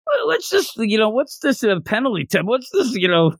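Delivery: a lightly hammed-up fan lost in the rules; bewildered newcomer griping at the ref; mock exasperation. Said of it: "I get all upset".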